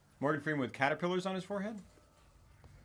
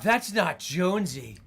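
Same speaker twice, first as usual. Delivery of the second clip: slow, eerie mock possessed voice; lightly hammed creepy take; imitating a possessed man, slow; possessed Jonesy line; 5 semitones higher, 7 dB louder, slower than usual. Imitating possessed Damian Lewis.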